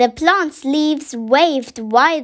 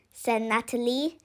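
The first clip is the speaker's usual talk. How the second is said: ordinary delivery, nothing marked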